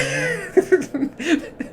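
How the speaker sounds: a long laugh that rises high. Real, not acted. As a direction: crack up with a high rising laugh. Laughing that nobody knows his job.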